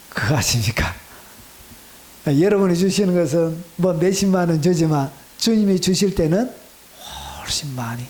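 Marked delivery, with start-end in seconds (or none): 0.1-0.9 hushed confiding whisper. Acted. Confiding aside to congregation. 7.4-8.0 soft hushed whisper; whispered emphasis on abundance. Acted. Confiding aside to congregation.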